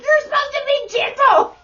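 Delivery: high, loud mock yell; shrill retold reprimand at full volume; exasperated scolding. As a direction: scream it at a biting pet. Retelling scolding someone trying to bite.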